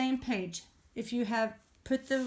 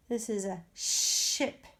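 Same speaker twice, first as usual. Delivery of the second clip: slow exaggerated speech modeling; slower than usual. Shown as a demonstration. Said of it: "modeling words for children".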